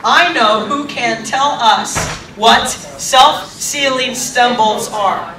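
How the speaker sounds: very slow, word-by-word delivery; careful enunciation. Shown as a demonstration. Actor repeats his tongue-twister line for the audience, slow and careful. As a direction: spell out the tongue twister slowly and deliberately. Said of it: "let's go over that line one more time".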